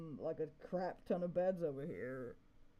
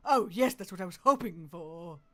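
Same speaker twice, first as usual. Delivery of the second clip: louder, delighted cheer on oh; thrilled; 11 dB louder than usual. Real, not acted. Streamer finding music discs, cheering loudly. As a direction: cheer it out with joy. Finds loot in chest.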